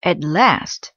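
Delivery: plain reading voice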